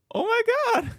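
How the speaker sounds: gasping high, loud shock. Real, not acted. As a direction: gasp and cry out in disbelief. Shocked by mother's voice.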